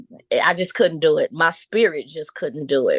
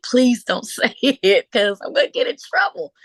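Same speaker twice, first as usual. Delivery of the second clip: higher, louder, laughing through a pleading whisper-plea; pleading son; 5 semitones higher, 3 dB louder than usual. Retold or imitated. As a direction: beg him not to tell, laughing. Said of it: "my son was in the background like".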